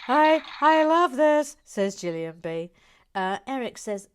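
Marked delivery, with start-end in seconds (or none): 0.1-1.6 loud, high, drawn-out delight; slow, emphatic gush, voice pushed up; gushing quote. Acted. Host reads out Gillian's message, gushing loudly.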